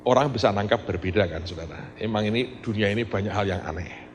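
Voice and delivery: ordinary talk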